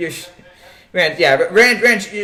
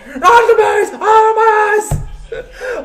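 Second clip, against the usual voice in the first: high, loud hammed-up scream, breaking into a laugh; a comic movie-style shriek of panic; mock scream; 5 dB louder, much slower than usual. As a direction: scream it like a terrified man, half laughing. Wicker Man scream quote.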